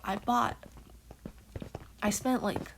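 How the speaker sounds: normal talking voice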